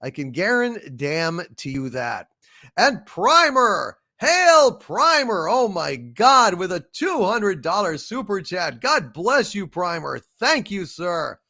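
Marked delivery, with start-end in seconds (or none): 2.8-9.1 excited. Real, not acted. The streamer cheering a donor for a big super chat, thrilled and loud. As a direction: whoop it out, thrilled.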